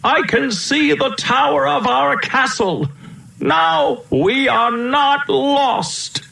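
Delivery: very high, bright relieved call; excited prince. Acted. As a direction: ring it out in triumph. Prince spots the castle.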